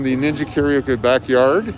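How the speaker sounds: usual speaking voice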